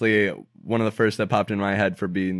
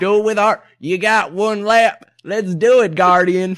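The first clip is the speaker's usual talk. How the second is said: high, loud southern cheerleading, hammed up; rally them in an exaggerated southern twang; [name], southern accent; 10 semitones higher, 9 dB louder than usual. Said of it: "Why do I have a southern accent".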